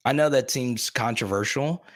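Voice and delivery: normal talking voice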